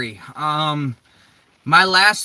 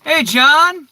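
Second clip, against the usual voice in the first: calling out; 10 semitones higher, 8 dB louder than usual. Real, not acted. Greeting viewer on live stream.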